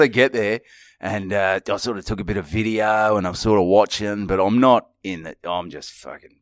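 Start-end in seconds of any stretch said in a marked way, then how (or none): none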